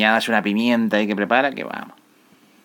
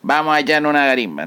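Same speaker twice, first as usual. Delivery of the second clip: louder, higher, matey invitation to a beer; inviting for a beer; 7 semitones higher, 5 dB louder than usual. Retold or imitated. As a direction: call a friend out for a drink. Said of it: "aquí usamos la palabra garimba".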